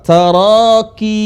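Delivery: Taraqi with qi held for two counts; two-beat qi. Shown as a demonstration. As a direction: hold qi of Taraqi for two beats. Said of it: "dua harokat". (qi dipanjangkan dua harokat)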